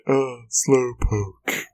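slow, yawning sigh of a voice; Slowpoke impression. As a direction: drag it out like a yawn. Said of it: "That's a pretty good Slowpoke impression".

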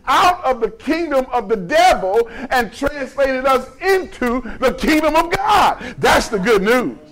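high, driving pulpit proclamation; forceful preaching. Real, not acted. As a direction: ring out each point of good news.

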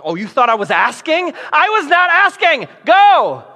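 loud, rising, fed-up order; wife's sharp command. Retold or imitated. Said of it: "she gives him one of those wife looks".

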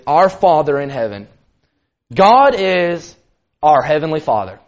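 ordinary talk

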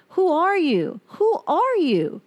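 high, strained, near breaking; pleading, pained and slow on the second; anguished. Acted. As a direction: cry out who are you in disbelief. Anguished question in breakup letter.

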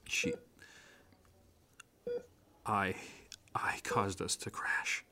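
slow guilty whisper. Acted. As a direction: confess it under your breath. Confessing guilt in hospital.

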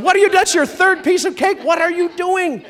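high, loud scolding yell, half laughing; wife catching husband at third piece of cake, mock shouting. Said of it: "your wife will get you anyways".

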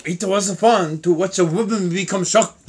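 slow, deliberate mock Japanese accent. Showing the co-host the suspect's quote, Japanese accent. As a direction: put on a Japanese accent and take it slowly. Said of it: "try more for a Japanese accent".

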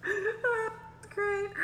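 high laughing delight. Real, not acted. Co-host laughing at the mother's praise. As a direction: laugh through it with joy. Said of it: "starts to laugh".

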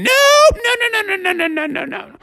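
high rapid string of refusals fading away; adamant mother. Acted. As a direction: start high on "no" and tumble downward. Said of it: "Mother's like".

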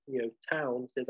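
usual speaking voice

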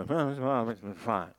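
mumbled tinny PA voice; muffled airport announcement. Retold or imitated. Preacher mocking unclear airport announcements, muffled PA. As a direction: mimic a garbled loudspeaker. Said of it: "That's usually the way it sounds".

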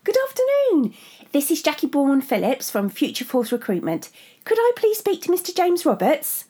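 high, bright telephone receptionist; chirpy voice. Acted. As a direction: chirp it like a cheerful recruiter. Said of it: "unbearably chirpy".

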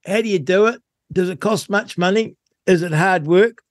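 high, punchy radio host opener; radio show intro. Acted.

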